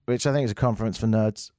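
usual speaking voice

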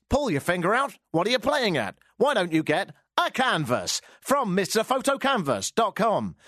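big swooping radio-commercial sell; hammed-up live advert read; cheesy plug voice. Shown as a demonstration. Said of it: "I'm gonna go ridiculously uber cheesy".

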